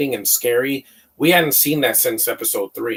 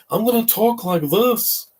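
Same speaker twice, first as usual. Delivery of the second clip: much higher, slower put-on voice imitating an actor; Matt Smith voice; 11 semitones higher, slower than usual. Mocking a movie character who talks like Matt Smith, high-pitched imitation. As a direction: mimic a quirky Doctor Who delivery, pitched way up and drawn out. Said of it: "He's like".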